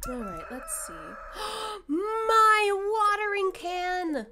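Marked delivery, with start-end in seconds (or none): 1.0-1.8 high, sharp gasp of dismay; shocked gasp. Real, not acted. Streamer remembers the watering can, shocked gasp. 1.9-4.3 high, loud, drawn-out wail; dismayed cry. Real, not acted. Streamer realizes the watering can is gone, loud dismayed cry.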